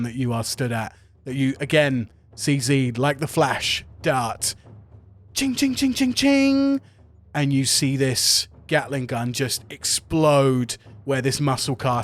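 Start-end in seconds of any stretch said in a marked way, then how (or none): 5.4-6.8 high, metallic blade chings; high repeated ching, last one ringing; slashing sound effect. Acted. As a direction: rattle off ringing sword strikes. Game master imitates rapid slashes.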